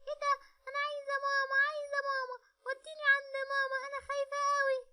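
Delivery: put-on a scared child begging for mama; frightened little girl. Little girl voice, crying for mother. (بنت صغيرة خايفة بتعيط وعايزة مامتها بصوت عالي)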